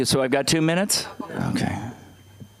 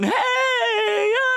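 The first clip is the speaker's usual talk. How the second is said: much higher mock cheer; cheering crowd. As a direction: whoop like a happy crowd. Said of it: "people out there going".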